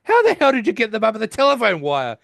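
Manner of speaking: high, loud and fast in disbelief; Penny blurting out in disbelief; incredulous outburst. Retold or imitated. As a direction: blurt it out in shock. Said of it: "she goes".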